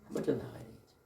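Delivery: a soft, breathy whisper to the congregation; whispered answer. Real, not acted. Preacher whispers the answer to his congregation, hushed.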